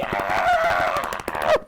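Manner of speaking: loud low startled yell; shocked scream. Real, not acted. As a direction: let out a stunned scream. Scream at donation.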